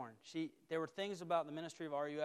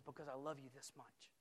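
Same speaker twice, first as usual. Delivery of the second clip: Jesus speaking tenderly; 9 dB quieter than usual. Acted. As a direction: hush it, as a gentle assurance. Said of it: "And he says".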